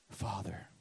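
a single whispered word; whispered prayer. Real, not acted. Preacher whispering to God after the sermon's teaching. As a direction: breathe it out softly.

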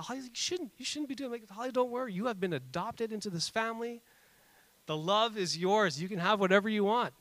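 lightly voiced doting mother; mock mother voice. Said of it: "She said".

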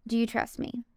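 soft, low question from God; God's voice. Retold or imitated. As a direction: voice God asking quietly. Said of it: "He said".

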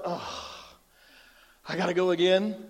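low, weary mock reluctance; reluctant groan. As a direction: sigh it out like a bored churchgoer. Reluctant churchgoer voiced in sermon.

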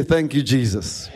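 ordinary delivery

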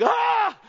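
high, loud startled yelp; startled shriek. Retold or imitated. Grandson shrieks when his unconscious granddad wakes, high yelp. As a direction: jump out of your skin with a shriek.

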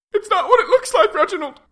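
very high, fast pleading; panicked wife voice. Acted. Wife panics when caught.

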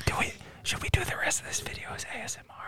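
soft unvoiced whisper. Real, not acted. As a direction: whisper it close to the mic. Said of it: "we're whispering into the mics".

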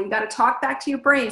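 ordinary talk

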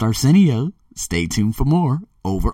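ordinary talk, nothing marked

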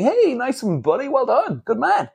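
high, chummy back-slapping cheer; mock team praise. Imagined team cheering the driver with high, hearty praise. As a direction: gush like a team boss patting your back. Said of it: "slapping me on the back and saying".

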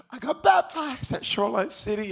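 high, eager voice of a new believer; high-pitched proud announcement; imitated excited announcement. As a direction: imitate a guy bursting with news. Gym guy announcing his baptism.

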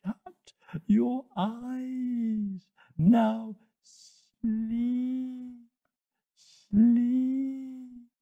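high, very slow, stretched-out vowels; hypnotic hissing snake. Acted. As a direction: lull them under with endless hissing words. Snake lulls Toto to sleep.

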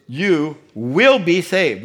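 WILL stretched out, loud and slow; absolute certainty. Real, not acted. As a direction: lean hard on WILL, no room for doubt. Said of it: "See how absolute".

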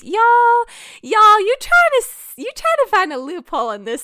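high, loud playful scolding; hammed-up exasperated shout; mock outrage. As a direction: call them out in exaggerated disbelief. Mock scolding the viewers' loophole answers.

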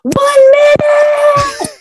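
very high, drawn-out fan yell; full-voiced squealed shout. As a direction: holler it long and high like an obnoxious fan. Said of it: "we kept yelling".

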